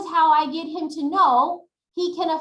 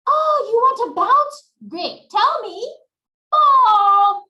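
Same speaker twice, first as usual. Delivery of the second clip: put-on high, loud and bright-eyed praise for a small child; upbeat child-directed voice; 7 semitones higher, 7 dB louder, slower than usual. Shown as a demonstration. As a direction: cheer the child on, much higher and louder. Cheering on a child during therapy.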